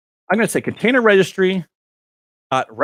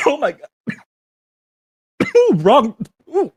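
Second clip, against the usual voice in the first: choking on water; 9 semitones higher than usual. Real, not acted. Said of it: "That went in my lungs".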